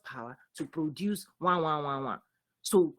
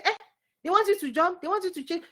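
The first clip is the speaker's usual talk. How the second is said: high-pitched incredulous objection; mock-indignant, high-pitched protest; acted protest; 9 semitones higher than usual. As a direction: push back with a voice jumping up high. Acting out resisting others' demands.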